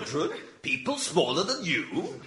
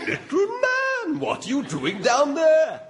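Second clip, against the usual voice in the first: high, loud mock giant voice; hammy booming giant; 11 semitones higher, 3 dB louder than usual. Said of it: "Because I am a giant".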